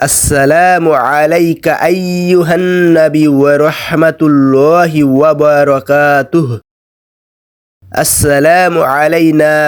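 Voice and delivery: slow, drawn-out Arabic prayer recitation; careful Tashahhud recitation. Shown as a demonstration. Teacher showing viewers the Tashahhud, slow melodic recitation. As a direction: recite it beautifully, holding the long vowels. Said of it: "খুব সুন্দর করে আপনাদের তাশাহুদের উচ্চারণ দেখিয়ে".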